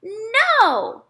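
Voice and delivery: put-on high loud toddler cry of no; child refusing shoes, high and loud; toddler's no. A narrator's reading. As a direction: cry out like a stubborn little kid. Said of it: "he said".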